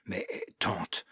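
put-on whispered sob of a broken child; soft, half-voiced sobbing plea. A narrator's reading. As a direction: breathe it out through tears. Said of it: "sanglota Criquet".

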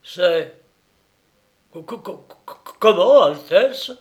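flustered, stuttering high burst; stammering. A narrator's reading. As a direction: stammer in shock. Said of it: "balbutia ... démonté".

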